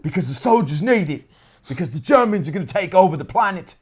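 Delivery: bad Cockney Churchill impression; Cockney accent. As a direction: put on a terrible Cockney accent. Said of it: "Winston Churchill with a terrible Cockney accent".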